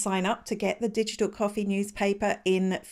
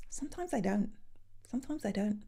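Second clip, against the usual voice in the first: sheepish admission; 13 dB quieter, faster than usual. Real, not acted. Host confesses to viewers she shares unread articles, quiet and sheepish. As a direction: drop your voice right down to own up.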